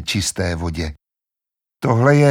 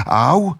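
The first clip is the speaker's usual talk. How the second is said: sudden high yelp of pain; Edmund's yelp; 7 semitones higher, 4 dB louder than usual. Acted. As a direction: cry out as if stung. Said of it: "najednou sám vykřikl". (Edmundovo vyjeknutí bolestí)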